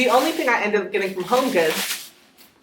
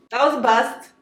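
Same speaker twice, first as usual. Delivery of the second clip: disappointed; 5 semitones higher, 4 dB louder than usual. Real, not acted. Repeats disappointment.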